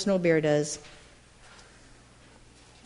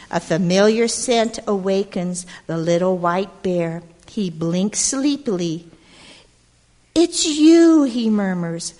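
put-on lively read-aloud to a group of kids; storybook narration; 7 dB louder, slower than usual. A narrator's reading. Storyteller reading to gathered children, lively narration. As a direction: tell it like a picture book at storytime. Reads picture book aloud.

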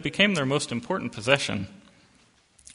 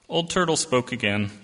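ordinary delivery, nothing marked

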